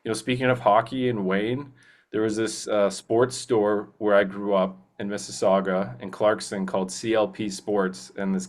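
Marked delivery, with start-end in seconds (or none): none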